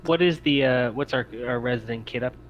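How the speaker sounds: ordinary talk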